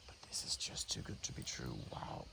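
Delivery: real awed whisper; whispered in wonder; hushed whisper. Real, not acted. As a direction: breathe it so the snake stays calm. Awed at snake eating rat.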